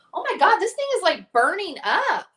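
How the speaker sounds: high, loud, alarmed exclamation; shocked exclaim. Real, not acted. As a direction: react to something scalding. Touching a very hot light.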